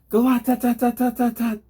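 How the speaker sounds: high, loud tat-tat-tat machine gun noise; imitated gunfire. Narrator imitates the thug's machine pistol, high and loud. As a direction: spit out a burst of gunfire with the mouth.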